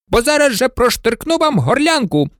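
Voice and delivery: high, outraged colonel's exclamation; indignant cry. A narrator's reading. Colonel demanding the insult be withdrawn, high indignant cry. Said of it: "вигукнув полковник стрільців".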